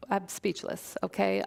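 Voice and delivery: usual speaking voice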